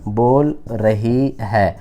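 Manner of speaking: very slow, stretched words; slow dictation. Shown as a demonstration. Teacher dictating the Hindi sentence to students, slowly. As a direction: dictate it slowly so they copy. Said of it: "गौर से समझना बच्चे".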